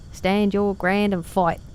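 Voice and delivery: mock Cockney with drawn-out, mangled vowels, "ground" turned into "grand"; bad Cockney accent. Streamer mocking an actor's film line, bad Cockney accent. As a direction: do a really bad Cockney, slowly. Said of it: "Cockney accent, ... It's really bad".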